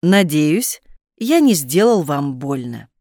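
ordinary talk

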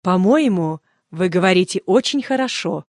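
ordinary delivery

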